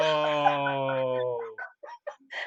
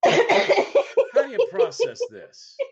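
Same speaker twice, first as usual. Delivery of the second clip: high, slow, stunned disbelief; husband dazed after being hit, asking in high slow disbelief; bewildered; 7 dB louder, much slower than usual. Retold or imitated. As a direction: ask the room how to process this, much higher and drawn out. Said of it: "looking around the room going".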